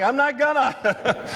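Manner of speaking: slow, high, amused retort; mock deadpan reply. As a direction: answer the madman dryly. Deadpan reply to screaming man.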